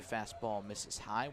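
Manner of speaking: ordinary delivery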